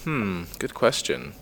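slow, musing hmm before answering; thinking hmm. Real, not acted. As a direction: stall and ponder, drawn out. Pondering interviewer's question.

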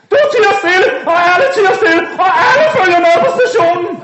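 high, loud shouting cop; police shout. Acted. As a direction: bark the raid order. Police orders everyone.

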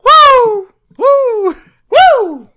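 very high, loud hype whoops; excited whooping. Acted. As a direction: whoop like a rowdy crowd. Hyped shouting at a fight.

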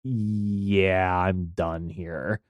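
low, soft fed-up sigh of a line; lightly voiced weary give-up; done with it. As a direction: mutter it like you're walking out. Quoting own reaction to bad movie.

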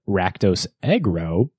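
ordinary talk, nothing marked

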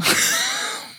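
shaky nervous giggle; nervous laugh. Real, not acted. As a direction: laugh nervously, it's all too real. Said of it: "I've fallen into just nervous laughter now".

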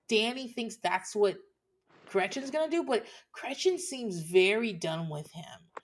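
ordinary talk

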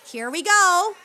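put-on high, loud kids' party host; singsong puppet show host. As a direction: pump up the kids like a children's entertainer. Clown hosting kids' party.

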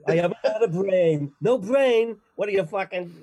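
hammed-up Boston incredulity; Boston accent. Oz characters discuss a missing brain, loud Boston accent. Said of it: "have a Massachusetts accent".

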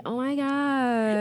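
put-on slow, drawn-out Valley Girl exclamation. Shown as a demonstration. Demonstrating least favorite accent, mock Valley Girl. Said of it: "like, the Valley Girl".

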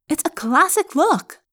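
bristle, defending the outfit; angry. Acted. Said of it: "Rachel said angrily".

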